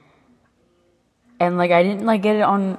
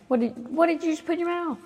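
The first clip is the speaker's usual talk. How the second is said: much higher, fast pet-scolding voice; mock scolding pet; 11 semitones higher, faster than usual. Real, not acted. Scolding pet that ate something.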